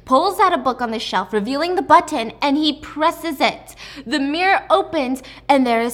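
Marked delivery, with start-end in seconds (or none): none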